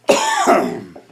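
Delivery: dry cough from dust in throat. Real, not acted. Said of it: "Got some dust in my throat".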